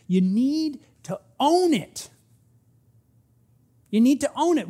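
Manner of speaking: preacher hammering the command; emphatic. Real, not acted. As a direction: press the point home hard. Preacher driving home the point.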